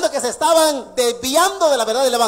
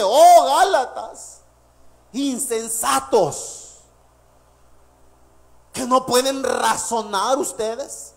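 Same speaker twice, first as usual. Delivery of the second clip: harsh rebuke; much slower than usual. Acted. Preacher as Paul, hurt, scolding the Galatians slowly. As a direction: lament and scold at once. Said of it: "aquí ya va Pablo bien agresivo y bien duro".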